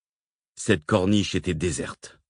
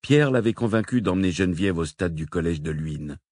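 ordinary delivery

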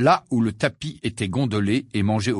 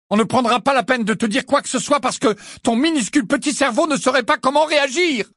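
much higher and louder, building to a shout; furious rant; 10 semitones higher, 5 dB louder than usual. Acted. Harry raging at the portrait about being kept out, shouting. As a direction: pour out the grievances at full volume.

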